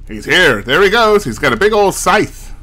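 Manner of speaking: put-on glamorous old Hollywood star; lightly put-on mid-Atlantic accent. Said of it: "he can't hear me talking in a m-, mid-Atlantic accent".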